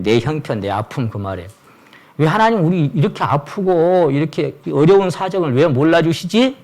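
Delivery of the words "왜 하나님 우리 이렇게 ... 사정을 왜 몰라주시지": a higher, pleading voice of a hurting believer; plaintive complaint to God. Retold or imitated. Pastor voicing a hurting believer's complaint, higher and pleading. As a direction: voice the complaint as if wounded. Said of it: "이렇게 얘기하는 거죠".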